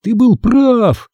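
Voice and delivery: high, slow, drawn-out exclamation; mildly winded, urgent announcement; acted out of breath. A narrator's reading. Boy runs home to tell Todd he was right, mildly breathless. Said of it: "запыхавшись".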